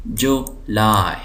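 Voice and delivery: July slowly as JU, laai; syllable by syllable. Shown as a demonstration. Teacher showing two syllables, July slowly split. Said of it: "दो syllable हैं".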